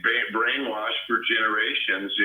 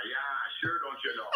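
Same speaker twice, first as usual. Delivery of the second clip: a soft, quiet Minnesota accent on "don't you know"; Minnesota twang; 4 semitones higher, 8 dB quieter than usual. Shown as a demonstration. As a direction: lay the Minnesota twang on lightly. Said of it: "I can throw the twang in there".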